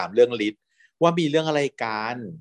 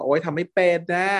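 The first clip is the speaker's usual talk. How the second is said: whiny, pitched-up complaint; mock whine; 6 semitones higher than usual. Guy whining to his boyfriend he can't cook, mock whine. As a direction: sulk it out like a spoiled kid. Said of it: "งอแงบอกว่า".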